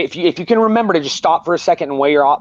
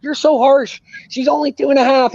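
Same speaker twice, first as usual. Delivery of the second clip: higher-pitched imitation of a scolding grandmother; imitating his mom; 11 semitones higher than usual. Son imitating his mom scolding him about being harsh, higher. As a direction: put on mom's higher voice to scold the harsh dad. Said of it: "My mom's like".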